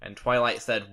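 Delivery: usual speaking voice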